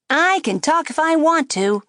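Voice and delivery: high, loud wisecracking puppet; a brash ventriloquist dummy needling; cheeky dummy voice. A narrator's reading. Dummy mocking the girl at the table, high and brash. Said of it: "the dummy said".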